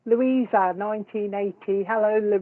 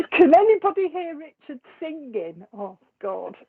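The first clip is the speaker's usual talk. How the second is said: higher-pitched question breaking out of giggles; laughing; 5 semitones higher than usual. Real, not acted. Asking viewers if they can hear Richard singing, laughing. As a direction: ask it through real laughter.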